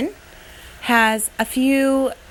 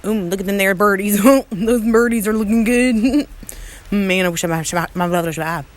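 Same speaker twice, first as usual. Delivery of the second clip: deep hillbilly character voice, rambling to himself; old country bumpkin; 6 semitones lower, faster than usual. Acted. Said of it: "back to our country bumpkin".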